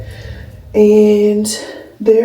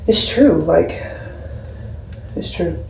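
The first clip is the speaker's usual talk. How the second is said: ordinary talk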